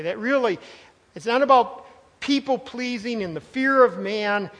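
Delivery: ordinary delivery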